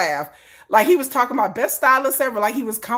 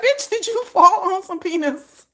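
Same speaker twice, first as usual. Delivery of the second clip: laughing quote; 8 semitones higher than usual. Retold or imitated.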